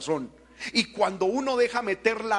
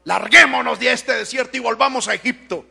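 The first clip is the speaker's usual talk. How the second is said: louder mock demand to leave; fed-up call; 3 semitones higher, 9 dB louder than usual. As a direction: voice the grumbling crowd. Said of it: "¿Sabe qué estaban queriendo decir?". (el pueblo harto, más fuerte)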